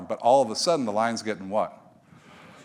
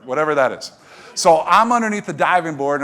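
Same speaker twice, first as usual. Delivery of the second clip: ordinary talk, nothing marked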